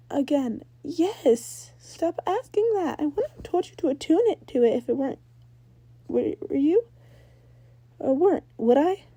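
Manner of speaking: exasperated Ember. Acted. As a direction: roll your eyes with the voice.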